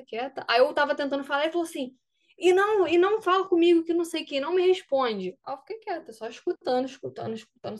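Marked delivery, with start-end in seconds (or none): 2.4-5.4 louder, sharp telling-off; mock coach shutting her down; retold harsh reprimand. Coach silences the crying player, sharply.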